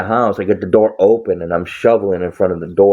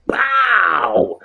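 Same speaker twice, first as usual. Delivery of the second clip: high shouted pow; sudden high cartoon pow; punch sound effect. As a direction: shout it like a knockout blow.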